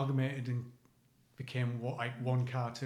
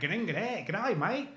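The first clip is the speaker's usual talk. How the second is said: higher, put-on Australian greeting; Australian accent; 5 semitones higher, much slower than usual. Contrasting Australian with Cockney, put-on Australian accent. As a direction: exaggerate an Australian hello with lilting pitch. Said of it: "then you've got Australian".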